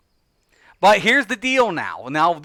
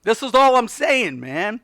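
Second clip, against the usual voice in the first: ordinary talk, nothing marked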